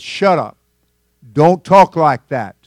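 loud, slow, stern scolding; loud rebuke. Retold or imitated. Rebuking the Titanic boaster, slow loud scolding. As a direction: shut him up firmly. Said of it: "and said".

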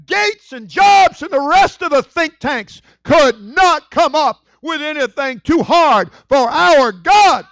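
loud, high, slow preacher's build; fiery preaching. Acted. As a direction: preach it full force to the church. Preaches to congregation.

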